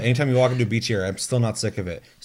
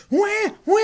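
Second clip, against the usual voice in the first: high, louder mock wail; imitated baby crying; 5 dB louder than usual. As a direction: cry out like a baby. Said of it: "B B started crying".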